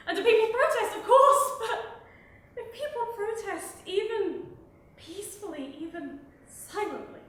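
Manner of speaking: loud, high, building to a shouted peak; rising indignation, driven harder with each phrase; impassioned outrage. Real, not acted. Poet condemning police abuse to an audience, in mounting outrage.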